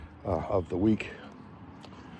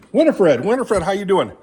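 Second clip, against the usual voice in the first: cheery greeting; 8 semitones higher, 6 dB louder than usual. Real, not acted. Greeting the horse Winifred.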